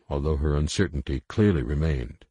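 plain reading voice

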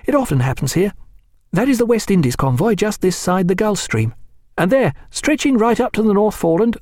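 lightly voiced keen young officer. A narrator's reading. Reed voiced.

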